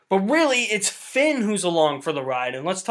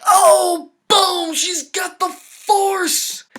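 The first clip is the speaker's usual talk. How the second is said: high, loud burst of amazement; drawn-out, high-pitched eureka yell; excited outburst; 12 semitones higher, 6 dB louder, much slower than usual. Retold or imitated. Viewer realizing she has the Force, excited outburst. Acting out his thrilled realization.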